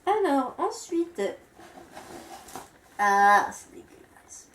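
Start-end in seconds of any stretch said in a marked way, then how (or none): 3.0-4.5 slow, drawn-out disgust. Real, not acted. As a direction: recoil at something gross. Dégoût en ouvrant le produit. (dégoûtée)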